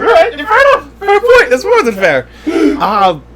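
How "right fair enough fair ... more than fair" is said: high laughing agreement; laughing concession. Real, not acted. Conceding the point to cohost, laughing high. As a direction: give in while laughing.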